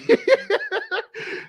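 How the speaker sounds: high, giggling laugh; real laugh. Real, not acted. Laughing at his enemy punchline, real laugh. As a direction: crack up at your own joke.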